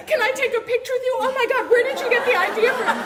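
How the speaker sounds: high, laughing, starstruck gushing; breathless fan fawning over the author she admires. Retold or imitated.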